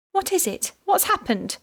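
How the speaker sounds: high, panicked questions; blurted in sudden alarm; panic-stricken. A narrator's reading. Woken wife asking the police at her door, panic-stricken. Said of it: "panic-stricken".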